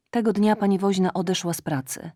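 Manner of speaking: plain reading voice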